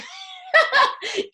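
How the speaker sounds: sudden high laugh; burst of laughter. Real, not acted. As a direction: crack up at the memory.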